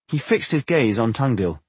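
usual speaking voice

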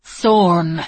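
loud American bellow. Acted. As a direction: bellow the name across water. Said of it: "bellowed in an American accent".

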